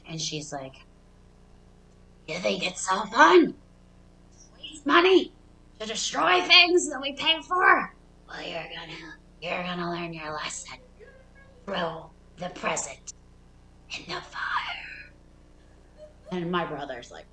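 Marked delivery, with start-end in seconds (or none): 2.3-7.9 high, loud, indignant scolding; mock furious mom. Mom at the trash fire, scolding the kids for wasting money. 8.3-15.1 menacing whisper. Retold or imitated. Mom quietly ordering the kids to burn the present.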